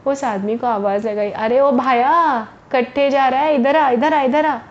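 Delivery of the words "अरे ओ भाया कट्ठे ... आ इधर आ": calling out. Acted. Merchant calls out to a porter walking past, loudly. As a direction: hail him from a distance in a rustic Haryanvi way. Lala calls the passing man over.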